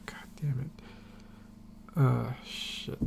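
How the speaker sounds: near-whispered, exasperated self-reproach; frustrated muttering. Real, not acted. Reviewer blanking on a character's name, muttering in frustration. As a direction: swear under your breath, slowly. Forgot a name mid-sentence.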